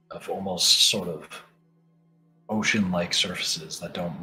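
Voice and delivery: slow hushed scene setting; game master description. Acted. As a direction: paint the eerie realm slowly.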